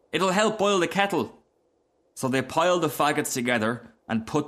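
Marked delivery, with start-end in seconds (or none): 0.1-1.4 put-on high, eager child; little boy's voice. A narrator's reading. Boy suggesting fuel for the fire, high and eager. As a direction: propose it like a small boy. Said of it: "said the other boy".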